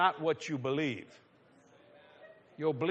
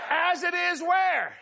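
building preacher shout; 8 semitones higher, much slower than usual. Acted. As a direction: build each where to a shout.

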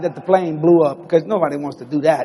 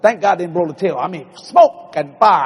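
ordinary talk, nothing marked

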